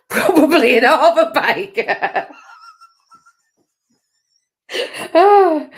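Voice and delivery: laughing through the words. Real, not acted. Host cracking up at her own joke, laughing. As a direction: giggle it out, drawn out slow.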